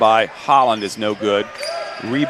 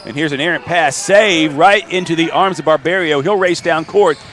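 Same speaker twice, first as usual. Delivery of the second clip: live call climbing higher as the play builds; excited play-by-play; 6 semitones higher than usual. Real, not acted. Announcer following a save and fast break, excited play-by-play. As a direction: build excitement through to the basket.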